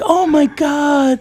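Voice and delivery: high, slow cooing dismay; mock the doting cat lovers; mimicking fussing onlookers. Mimicking fussing clinic people.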